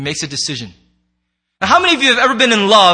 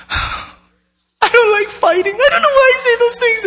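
tender wife voice; 13 semitones higher than usual. Retold or imitated. Wife reconciling after a fight.

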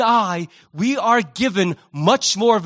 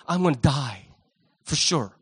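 deflated defeat; 7 semitones lower, 3 dB quieter than usual. Pretend. Bravado collapses into defeat.

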